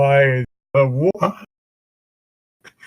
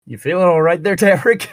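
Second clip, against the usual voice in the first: calling out; 8 semitones higher, 4 dB louder than usual. Acted. A character calling Tamrick, voice raised.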